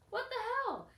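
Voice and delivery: high, loud incredulous outburst; retold disbelief. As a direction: re-enact being floored by their reaction. Her shocked reaction, retold.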